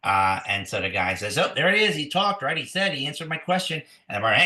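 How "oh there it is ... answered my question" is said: excited, higher-pitched insisting; triumphant dog owner. Acted. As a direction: crow that the trick worked. Acted dog owner claims victory.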